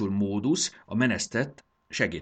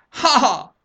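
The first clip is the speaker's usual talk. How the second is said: loud, high mad cackle; hammed-up lunatic "ha-ha"; crazed laugh; 14 dB louder than usual. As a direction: burst out in deranged laughter. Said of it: "eszelősen felkacagott".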